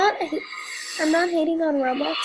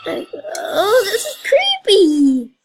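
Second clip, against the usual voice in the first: creeped out; 5 semitones higher, 7 dB louder, much slower than usual. Real, not acted. Scared reaction to creepy game.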